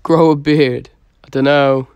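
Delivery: ordinary talk, nothing marked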